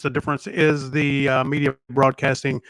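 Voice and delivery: ordinary talk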